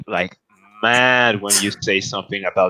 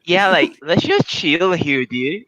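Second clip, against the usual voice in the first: put-on high, drawn-out LA valley-girl voice; exaggerated LA girl lilt; 6 semitones higher than usual.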